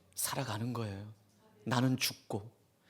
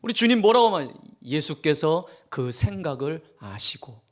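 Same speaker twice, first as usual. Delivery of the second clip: normal talking voice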